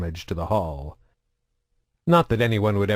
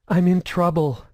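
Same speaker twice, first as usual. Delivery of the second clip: put-on higher, frightened young woman's quaver; 8 semitones higher, 3 dB louder than usual. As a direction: tremble through it, scared and pleading. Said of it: "she said in a quavering voice".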